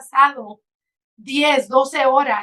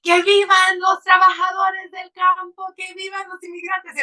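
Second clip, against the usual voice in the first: high cheering cry in Spanish, reliving the moment; retold joyful scream; 8 semitones higher than usual. Shouting out the car window to field workers, high joyful cheer. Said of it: "I just screamed from the top of my lungs".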